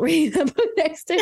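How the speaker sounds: quick words broken by laughter; giggling through the line; laughing. Real, not acted. Laughs while telling.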